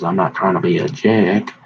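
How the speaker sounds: ordinary talk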